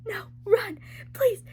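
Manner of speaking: frightened plea. Retold or imitated. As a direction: beg him to run. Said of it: "Farah was like".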